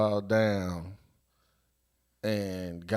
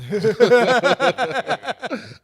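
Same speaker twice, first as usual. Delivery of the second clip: long, loud high-pitched laugh; real belly laugh; 13 semitones higher, 9 dB louder than usual. Real, not acted. Storyteller cracking up at his own story, real laughter. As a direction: crack up loudly and keep it going.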